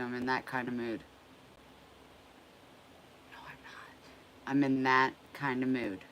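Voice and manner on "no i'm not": whispered denial, breathy and hushed. Real, not acted. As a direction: breathe it out as a secret. Whispered aside denying mood.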